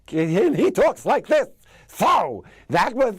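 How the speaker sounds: high, loud wobbly vaudeville voice; old vaudevillian, pitched high and loud; Ed Wynn voice. Shown as a demonstration. Actor showing the King Candy voice, high loud vaudeville impression. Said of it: "a voice match, uh, similar to his".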